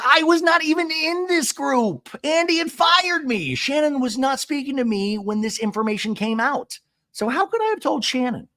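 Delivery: high-pitched, hammed-up indignation; indignant denial. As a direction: act out an outraged self-defense. Retold indignant denial in quarrel.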